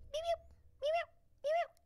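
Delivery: very high, soft beeps; imitated lone bird. Woman imitates the bird still calling, high soft chirps. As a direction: keep chirping with nobody answering. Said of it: "was just like".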